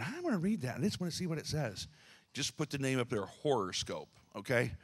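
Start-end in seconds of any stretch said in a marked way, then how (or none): 0.0-1.9 tempted reader. Retold or imitated. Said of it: "you're thinking".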